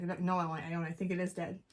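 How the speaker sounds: low, quiet insistence; retold earnest protest, kept soft; dead serious. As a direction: insist softly, completely in earnest. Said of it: "I'm dead serious".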